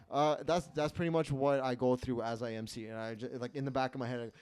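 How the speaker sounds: ordinary talk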